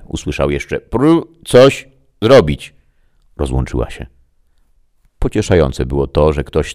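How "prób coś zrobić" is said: high, loud, slow broken transmission; a voice breaking up over a bad link; garbled radio voice. A narrator's reading. Greta calling Max through a failing link, garbled and high.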